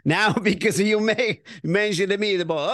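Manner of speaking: hammed-up Italian accent with added vowels. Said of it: "Oh, now I'm gonna do Italian".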